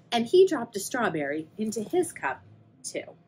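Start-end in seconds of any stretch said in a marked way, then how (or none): none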